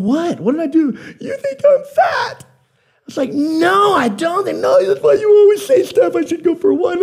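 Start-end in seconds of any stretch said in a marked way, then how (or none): 0.0-1.0 high, laughing what did I do; bewildered, half laughing; baffled. Retold or imitated. Husband baffled by wife's tears. 1.2-2.4 very high, slow, tearful wife; hurt wife voice. Retold or imitated. Wife hurt by treadmill gift. 3.3-7.0 flustered. Retold or imitated. Husband defending himself, flustered.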